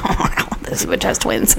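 slow, hushed whisper; whispered aside. Pretend. Imagined doctor thinking about her twins, slow whisper. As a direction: say it under your breath, slowly.

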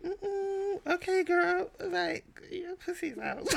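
high, shocked, sassy voice; mock-scandalized girl; sassy mock outrage. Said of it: "And I was just like".

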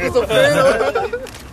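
slow, loud and high; slow silly mumble. Retold or imitated. Son answers mom, slow and silly. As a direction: drag out the joke. His sloppy reply.

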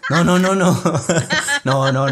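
loud laughing no no no; giggling denial; laughing protest. Real, not acted. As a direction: laugh while denying it. Laughing protest at own remark.

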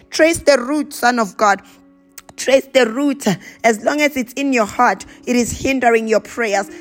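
ordinary talk, nothing marked

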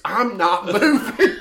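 loud, slow mock refusal, laughing; laughing retold refusal. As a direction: imitate a stubborn refusal, drawn out through laughter. Retold refusal while cracking up.

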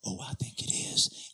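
low, slow, whispery threat; menacing customer. Retold or imitated. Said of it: "So he goes".

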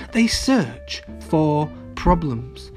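usual speaking voice

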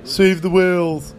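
mock goofy line; weird guy quip. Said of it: "He goes".

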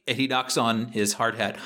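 usual speaking voice

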